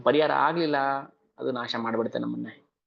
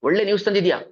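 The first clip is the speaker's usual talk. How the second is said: ಒಳ್ಳೆ loud and higher in joy; delighted Kaikeyi; 6 semitones higher, 6 dB louder, faster than usual. Acted. Kaikeyi delightedly rewarding Manthara for the news. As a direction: gush with delight over ಒಳ್ಳೆ news. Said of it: "ಪ್ರೀತಿಯಿಂದ".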